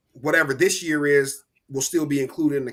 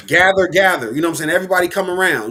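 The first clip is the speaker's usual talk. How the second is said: loud, higher barker calling a crowd over; carnival barker; 3 semitones higher, 7 dB louder, faster than usual. Retold or imitated. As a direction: round up the crowd like a huckster. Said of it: "Snake oil salesman".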